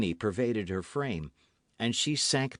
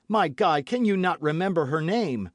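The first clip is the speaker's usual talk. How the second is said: higher, louder pleading; urgent plea; 6 semitones higher, 5 dB louder than usual. A narrator's reading. D'Artagnan begging Constance to remember, urgent. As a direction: beg her to remember.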